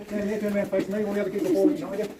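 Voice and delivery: usual speaking voice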